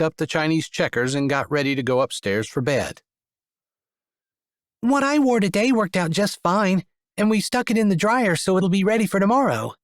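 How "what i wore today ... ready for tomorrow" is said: put-on high, cheerful little kid; much higher, eager child. A narrator's reading. Child Arian reassuring.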